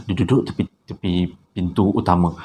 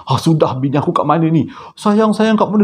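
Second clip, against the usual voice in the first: high loud panicked call; husband panicking outside the mosque, loud high calls; frantic shouting; 8 semitones higher, 3 dB louder than usual. Retold or imitated. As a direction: yell into the night for her. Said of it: "Dia jerit-jerit ni".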